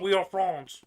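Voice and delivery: hammed-up bad French accent; fake French accent. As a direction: play a terrible cartoon French accent. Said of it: "fake French accent".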